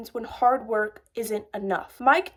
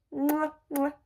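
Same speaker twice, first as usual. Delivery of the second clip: soft double air kiss; two kiss sounds; 6 dB quieter than usual. Real, not acted. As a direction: blow two quick kisses. Blowing kisses at sign-off.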